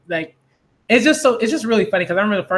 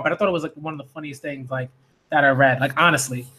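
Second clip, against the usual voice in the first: usual speaking voice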